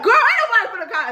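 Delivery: high-pitched mock indignation; imitated mad mama. Mama refusing to call cops, high mock anger. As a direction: dismiss it in a high, cross mimicking voice. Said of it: "my mama got mad".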